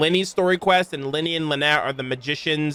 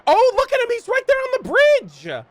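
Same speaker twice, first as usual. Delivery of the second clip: high, loud excited squeal imitating Lyney; mock Lyney voice; 4 dB louder than usual. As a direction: gush like a giddy kid spotting someone. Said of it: "Lenny is like".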